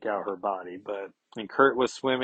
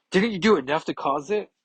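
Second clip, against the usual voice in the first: higher, louder bitter accusation; angry retort; 7 semitones higher, 4 dB louder than usual. Acted. Carol turns on Iron Man, loud high bitter accusation. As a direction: throw the blame back at him.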